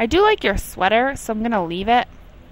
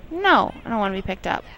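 ordinary talk

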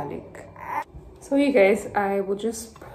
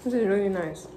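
speaking between bites of food; talking while chewing; 9 semitones higher than usual. Real, not acted. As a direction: mouth partly full of food. Seen 1: Bites food at -12, then lips keep working while she talks.

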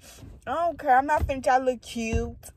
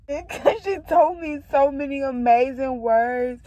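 ordinary talk, nothing marked

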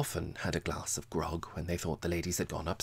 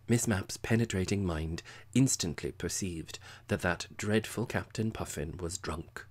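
plain reading voice

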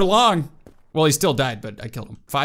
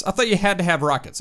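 ordinary delivery

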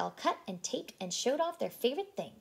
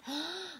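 gasp; 9 semitones higher, 5 dB quieter than usual. Acted. Reader gasps at idea.

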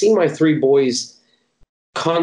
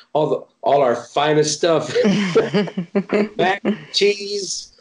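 giggling through the list, voice jumping higher; laughing; 6 semitones higher than usual. Real, not acted. Missionary tells interviewer about their treats, laughing. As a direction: laugh out the menu of comfort food.

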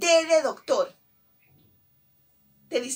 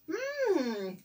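long, high, soft yummy hum; savouring "mmm"; 5 semitones higher, 5 dB quieter than usual. Pretend. Teacher seeing a picture of a sweet, savouring hum. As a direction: hum as if tasting something delicious.

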